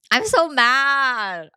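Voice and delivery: drawn-out, loud mock fury; hammed-up slow angry groan; slow, exaggerated put-on rage; mock anger. Acting out angry friend.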